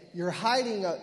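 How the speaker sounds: ordinary talk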